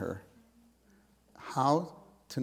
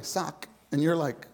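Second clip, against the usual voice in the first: usual speaking voice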